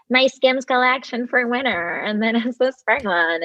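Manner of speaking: mock celebrity product plug; Kim Kardashian promo voice. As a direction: play a breathy-free slow sales voice, lightly mocking. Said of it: "Kim is on there doing".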